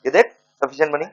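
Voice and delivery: ordinary talk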